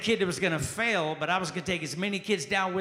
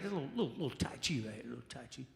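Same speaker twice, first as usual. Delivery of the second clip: much softer, lower, drifting murmur; hushed tai chi murmur; 6 semitones lower, 13 dB quieter, faster than usual. Shown as a demonstration. Comic doing tai chi on stage, hushed low murmur. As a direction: breathe it out as you move. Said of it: "this is Tai Chi right here".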